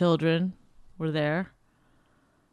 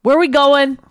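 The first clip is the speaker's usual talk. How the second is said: loud, raised-pitch mimicry of a grunty demand; mocking rude imitation; 7 semitones higher, 9 dB louder than usual. Woman mimics partner's question to brother, rudely. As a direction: bark it out as a mock grunt. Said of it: "this is what you said to him".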